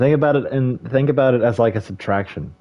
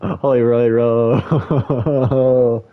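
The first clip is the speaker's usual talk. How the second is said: extremely drawn out; 3 dB louder, much slower than usual. Pretend. Streamer repeating the name, stretched way out. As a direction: stretch Hoy Roy Ro very slowly.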